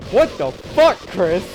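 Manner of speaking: high, loud, stunned outburst; amazed shout. Retold or imitated. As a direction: yell it in disbelief. Imagined friend's amazed reaction.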